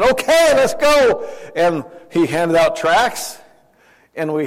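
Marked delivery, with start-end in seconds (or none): 0.0-1.3 high, loud eager call to go; rally everyone, keen to start. Retold or imitated. Father answering his son's call, eager shout. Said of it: "I said".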